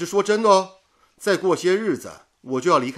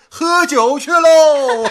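loud, high and slow, drawn-out whoop; gleeful cheer; 8 semitones higher, 8 dB louder, much slower than usual. Acted. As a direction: cheer everyone off to the drinks. Off to drink, cheering. (拖长声音的欢呼)

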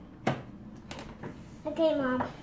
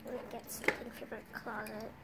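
whispering; 5 semitones lower, 11 dB quieter than usual. Pretend. Whispering by sleeping baby.

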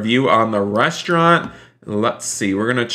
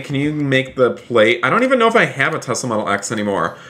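ordinary delivery

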